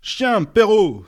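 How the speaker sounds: high, loud, slow hurled insult; furious insult. A narrator's reading. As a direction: spit it at the boss. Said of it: "fut la réponse".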